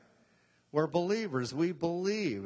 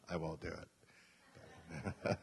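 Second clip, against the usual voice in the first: refusing; 8 semitones lower, 11 dB quieter, much slower than usual. Real, not acted.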